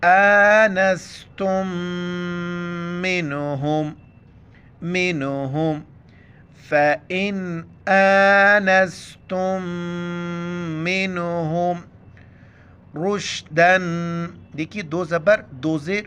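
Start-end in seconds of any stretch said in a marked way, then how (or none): 0.0-5.8 slow drawn آنستم, melodic; Quran recitation. Acted. Teacher recites the word for students, slow tilawat. 6.7-14.3 slow, drawn-out melodic tilawat; Quran recitation. Acted. Teacher reciting the verse for students, slow melodic recitation.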